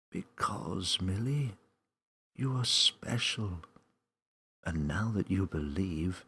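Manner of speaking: strong but calming voice welcoming Millie; calming mysterious voice. Acted. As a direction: reassure a scared child from everywhere. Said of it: "a strong but calming voice".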